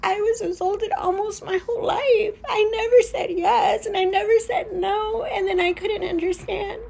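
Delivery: tearful, high, breaking voice; real sobbing through the words; fighting back tears, voice cracking; crying. Real, not acted. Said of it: "I've been crying".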